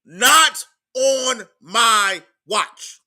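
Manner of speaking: each word spaced out and weighted, very slow; a rallying motto, every word stamped; slow emphatic declaration. Shown as a demonstration. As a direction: hammer home "Not on my watch." word by word. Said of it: "write it down in capital letters".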